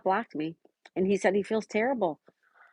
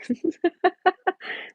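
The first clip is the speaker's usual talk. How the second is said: high laugh; 12 semitones higher than usual. Real, not acted. Laughing at chat accent comment.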